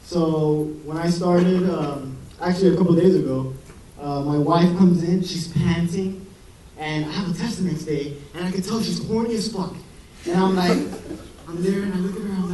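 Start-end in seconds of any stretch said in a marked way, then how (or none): none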